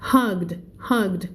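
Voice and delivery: hugged ending in a crisp D; hugged said with a clear final D. Shown as a demonstration. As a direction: land the D at the end of hugged. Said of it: "เป็น D นะคะ".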